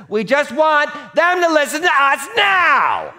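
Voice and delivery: high, loud shout to win the point; demanding shout that drowns the other person out; getting loud. Retold or imitated. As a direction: yell to make them listen. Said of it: "we get loud to make our point".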